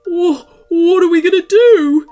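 loud, high, stammering wail; whimpering cartoon fright; worried. A narrator's reading. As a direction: stammer it in a near-crying panic. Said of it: "Woodrow said worriedly".

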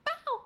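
high-pitched yelp of a bark; imitated bark. Showing friend how she barked, high mock bark. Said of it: "but so loud".

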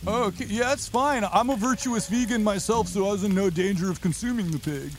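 high, dopey creature voice; Sasquatch voice. Acted. Sasquatch chatting with Bello, high dopey voice. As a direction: play the dim helpful Sasquatch.

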